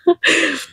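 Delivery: high laugh after a joke; short laugh. Real, not acted. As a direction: laugh at your own English accent. Rit de son accent anglais. (petit rire aigu)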